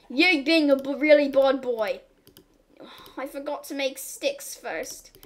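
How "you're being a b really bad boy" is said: loud playful scolding of a bad pet; mock stern owner. Owner scolding a naughty game animal, mock stern. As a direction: growl out a telling-off.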